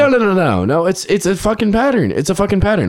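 frustrated friend venting about always meeting only couples at bars, voice pitched high; worked-up rant. Real, not acted. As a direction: pitch it way up, fed up with the pattern. Exasperated rant about bar pattern.

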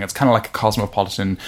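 ordinary talk

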